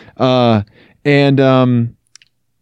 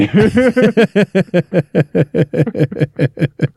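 laughing; 6 semitones higher than usual. Real, not acted. Laughing at own accent bit.

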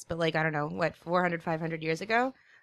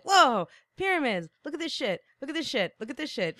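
awed tourist; 8 semitones higher than usual. Pretend. Imagined time traveller gawks at the pyramids, high and awed. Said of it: "being like".

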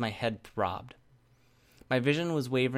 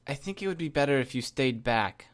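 slightly raised, firm caution; warning; 4 semitones higher, 4 dB louder than usual. A narrator's reading. Captor warns teammate away from hostage, firm caution. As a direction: warn her back without shouting. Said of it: "I warned her".

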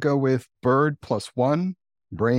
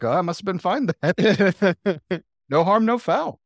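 laughing mock relief; 5 semitones higher than usual. Imitating hungover self.

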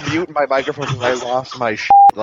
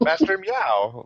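bad movie dubbing; 8 semitones higher, slower than usual. Shown as a demonstration. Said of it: "There you go".

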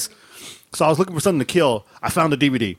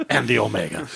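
grandiose; slower than usual. Pretend. Grandiose self-declaration.

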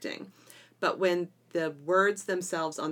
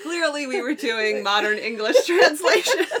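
laughing; 7 semitones higher, 9 dB louder than usual. Real, not acted. Laughing about stilted translations.

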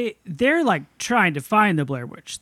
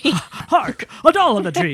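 put-on higher, louder old-timey declaiming; hammy stage actor; 7 semitones higher, 4 dB louder, slower than usual. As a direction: proclaim it like a bad Shakespearean.

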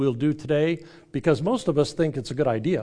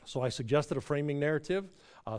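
ordinary talk

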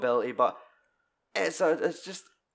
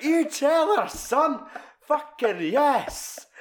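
put-on cheering kid; 14 semitones higher, 4 dB louder, much slower than usual. Kid cheering his father on.

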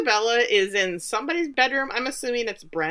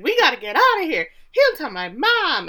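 higher, louder mock freak-out; louder, higher, slowing on the mom line; mock panic; 7 semitones higher, 6 dB louder than usual. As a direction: play the scared teen bolting, hammed-up. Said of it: "So she freaks out".

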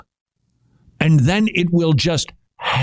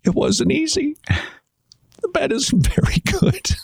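mock whimper. Joking about quarantining himself.

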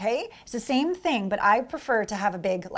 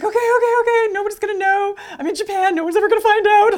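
much higher and louder, jittery hype; nervous self pep talk; 10 semitones higher, 8 dB louder, faster than usual. Retold or imitated. Showgirl pumping herself up before the stage, high and jittery. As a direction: psych yourself up, nervously. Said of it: "I'm like".

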